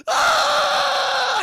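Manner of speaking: long, high, loud cartoon scream; mock terrified scream. Courage sees Bigfoot, long mock scream. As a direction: scream as the frightened cartoon dog. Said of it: "He's screaming".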